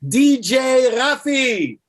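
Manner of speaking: big excited announcer call; announcing the guest. Real, not acted. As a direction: bring him on like a stage MC. Host announces guest onto show.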